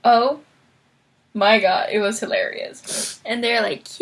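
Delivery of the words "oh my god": normal talking voice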